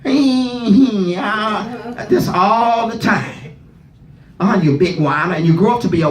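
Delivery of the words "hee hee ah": high mock whimpering of a little boy; imitating baby whine. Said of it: "been around little boy babies whining".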